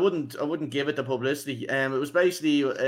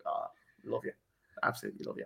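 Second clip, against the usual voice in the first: soft, breathless fan gush; hushed gushing; 2 semitones lower, 12 dB quieter, faster than usual. Retold or imitated. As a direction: whisper it like a starstruck fan. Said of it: "And I was like".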